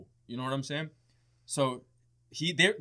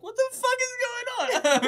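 very high, squealed comic panic; mock distraught; 4 dB louder than usual. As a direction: play up distress in a tiny high voice. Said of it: "completely distraught. I was just like".

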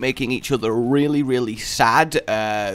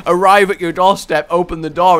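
normal talking voice

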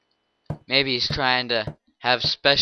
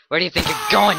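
taunt; 6 semitones higher, 7 dB louder, much faster than usual. Acted. Taunt repeated.

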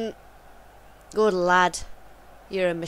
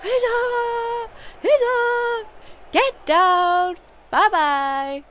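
much higher, louder triumphant chanting; gleeful celebration shouts; 5 dB louder, much slower than usual. Real, not acted. Gamer celebrating a sending off, high loud glee. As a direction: crow at the red card.

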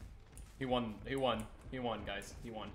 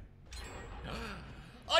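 1 mock gasp; 9 semitones higher than usual. Mock shock at a generator.

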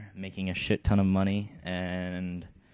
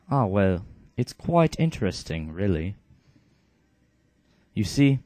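louder, lecturing British shaman; British accent; 3 dB louder than usual. Acted. Canius lecturing Bartray, lightly British. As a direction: explain magic like a polite British scholar. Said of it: "Canius is gonna have the British accent".

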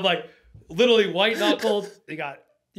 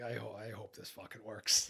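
nervous mutter; 8 semitones lower, 17 dB quieter than usual. Retold or imitated. Nervous on the boat.

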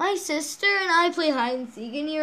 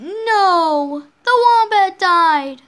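loud, higher, drawn-out mock wail; dramatic outcry; 6 semitones higher, 10 dB louder, much slower than usual. Player reacting to the wombat dying, loud dramatic outcry. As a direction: cry out in hammed-up dismay. Exclaims over the dead wombat.

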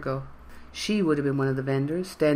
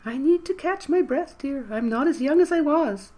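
much higher, frail granny voice; high quavery old woman; 11 semitones higher than usual. A narrator's reading. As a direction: croon it like an elderly lady. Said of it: "Mikkel responded".

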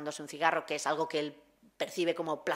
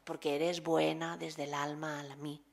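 poem recital; 2 semitones lower, 4 dB quieter than usual. Acted. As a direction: let each love line land gently. Recites Margarit love poem.

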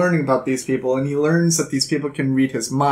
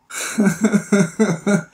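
higher, rapid run of laughter; 5 semitones higher than usual. Real, not acted. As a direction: break into a string of chuckles. Laughs at calling the tale boring.

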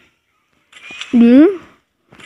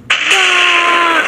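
startled shout; 7 semitones higher than usual. Real, not acted. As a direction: jolt out a yelp at the blast. Sudden blast in game.

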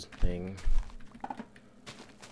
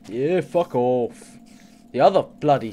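loud angry curse; 2 semitones higher, 10 dB louder than usual. Real, not acted. Swearing at spiders in game, angry yell.

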